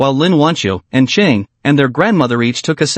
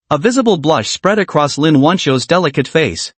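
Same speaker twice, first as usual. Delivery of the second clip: plain reading voice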